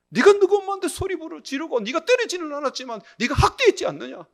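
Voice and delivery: high, forceful retold accusation; accusing rebuke. Inner voice convicting the deacon over his mother, accusing. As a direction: press the charge hard.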